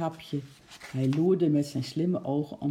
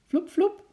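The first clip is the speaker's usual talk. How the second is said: two high, louder "vloep" pops; whooshing leap noises; 13 semitones higher, 6 dB louder than usual. A narrator's reading. Chimp bounding outside, high loud whooshes. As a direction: voice bounds as sound effects. Imitated sound of leaps.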